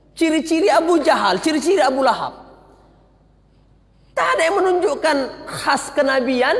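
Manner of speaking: ordinary talk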